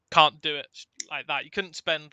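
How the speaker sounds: usual speaking voice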